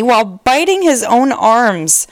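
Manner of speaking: ordinary delivery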